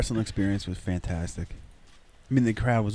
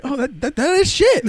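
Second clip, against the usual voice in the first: high shriek building louder; high disgusted shriek; 6 dB louder than usual. Retold or imitated. Disgusted realization.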